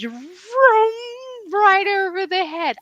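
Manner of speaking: high swooping vroom; whoosh sound effect. Retold or imitated. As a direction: sweep it over their heads. Customers not understanding, whoosh gesture.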